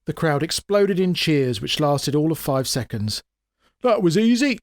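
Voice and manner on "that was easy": scoffing bystander. A narrator's reading. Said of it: "said a man".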